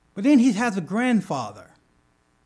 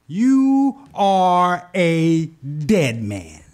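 God's voice; 5 dB louder, much slower than usual. Acted. God's threat in preacher's retelling.

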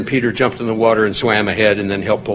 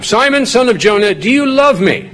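much higher, "love" pushed up high; building emphasis; 10 semitones higher than usual. Acted. Jesus asking Peter a second time, building emphasis. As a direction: press the question harder, pitch climbing on "love". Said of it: "to build up to the, you know, the peak".

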